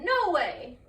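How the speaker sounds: loud cheeky refusal; defiant shout. Acted. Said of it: "the gingerbread man said".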